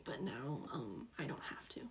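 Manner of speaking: softly whispered sulky aside; whispered sulk. Real, not acted. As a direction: drop to a pouty whisper.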